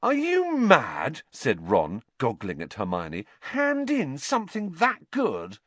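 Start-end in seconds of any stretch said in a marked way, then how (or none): 0.0-1.2 high, slow disbelief; incredulous. A narrator's reading. Ron gaping at Hermione's suggestion, incredulous. 3.4-5.6 high, disbelieving protest; can't believe the idea; appalled. A narrator's reading. Ron aghast at giving up the map, disbelieving.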